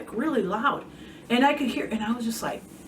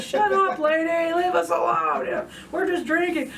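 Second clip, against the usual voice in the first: higher, mocking rude-guy voice; pitched-up imitation of a dismissive drunk; 8 semitones higher than usual. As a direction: brush off the lady with a sneer. Imagined rude drunk guys.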